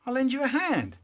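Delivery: a hint of a helpful big brother; brother's voice. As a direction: coax him along kindly. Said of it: "But he goes".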